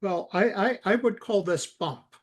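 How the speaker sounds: usual speaking voice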